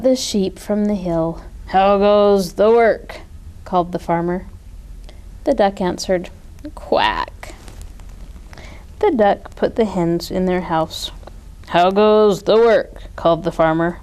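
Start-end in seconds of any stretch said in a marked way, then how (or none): 1.7-3.2 slow, loud booming farmer; lazy farmer calling from bed; farmer's voice. A narrator's reading. Farmer voiced in read story. 6.7-7.5 very low, breathless quack; a worn-out duck barely quacking; exhausted duck answering the farmer; whispered quack. A narrator's reading. Duck voiced in read story. 11.7-13.0 slow, loud booming farmer; lazy farmer calling from bed; farmer's voice. A narrator's reading. Farmer voiced in read story.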